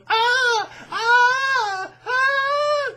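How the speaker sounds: high, loud mock screams of pain; shrill-free mock wail, cartoonish and loud; mock screaming. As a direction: ham up a kid screaming on the floor. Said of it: "he was screaming on the floor".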